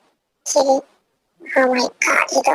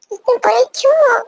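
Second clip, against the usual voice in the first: startled; 10 semitones higher than usual. Acted. Startled partner reacts.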